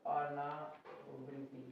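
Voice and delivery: slow dictation. Shown as a demonstration. Teacher dictating the term to children writing, slow dictation. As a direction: stretch अनावृत्ति part by part, quietly.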